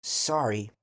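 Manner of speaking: usual speaking voice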